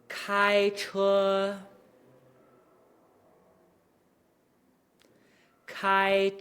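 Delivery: "开车" slow, held level high; practicing tones. Shown as a demonstration. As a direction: keep "开车" flat on one pitch. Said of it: "I wanna point something out right here".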